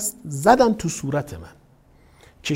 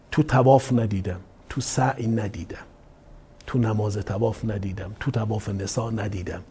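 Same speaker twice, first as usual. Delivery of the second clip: ordinary delivery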